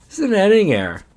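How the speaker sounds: high, loud burst of disbelief; exasperated outburst. Real, not acted. Gamer to viewers, yelping in loud disbelief. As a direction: yelp it out at the screen.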